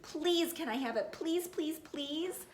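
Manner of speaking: put-on high whiny child's pleading; pleading please, high and drawn out; real begging. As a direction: beg like a little kid, pitch way up. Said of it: "real begging".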